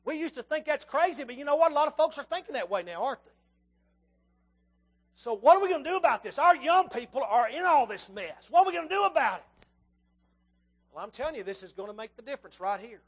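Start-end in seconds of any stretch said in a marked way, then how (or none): 5.1-9.7 loud, higher rallying cry; rally the church to act; rousing question. Acted.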